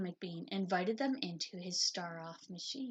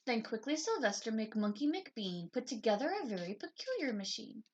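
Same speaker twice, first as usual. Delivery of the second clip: ordinary delivery, nothing marked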